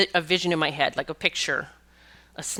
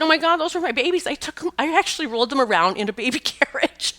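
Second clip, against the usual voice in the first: high, bright burst breaking into a laugh; as if suddenly recognizing long-lost pets; thrilled gasp turning into laughter; overjoyed recognition; 8 semitones higher than usual. Retold or imitated. Client recognizing her ferrets.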